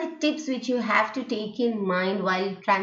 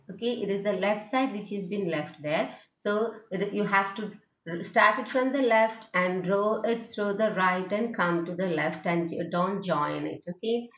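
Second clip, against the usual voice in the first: usual speaking voice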